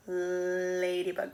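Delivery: "Ladybug" slow and deliberate; slow model of "Ladybug"; modeling a word. Shown as a demonstration. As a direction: draw out "Ladybug" for the class. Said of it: "What do you hear in the beginning".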